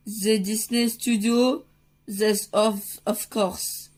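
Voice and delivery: halting, French-tinged American English; attempted American accent. Dad answering the Disney Studio, attempted American accent. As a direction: try hard at an American accent. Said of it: "mon accent américain s'est vraiment bien amélioré".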